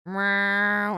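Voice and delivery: short, loud cat yowl; imitated cat yowl. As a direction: let out a loud protesting meow. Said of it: "And he's like".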